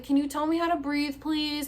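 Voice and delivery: high retold plea; mildly acted helpless begging; pleading. Patient asking helpers how to breathe, high acted pleading.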